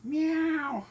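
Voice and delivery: high imitated meow at the cat; high playful cat meow; mock meow. Real, not acted. Meowing at his cat.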